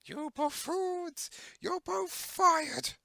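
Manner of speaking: high, slow villain boss yelling; angry boss voice. Pretend. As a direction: fire them like a cartoon villain. Voicing the boss firing henchmen.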